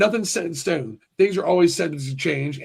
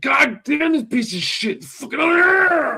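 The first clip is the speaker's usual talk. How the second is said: high, slow frustrated yelling at a game; furious gamer meltdown, drawn out; retold rage-out; 9 semitones higher, 3 dB louder, slower than usual. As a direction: scream it like a gamer losing it. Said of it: "when I fucking freaked out and I was like".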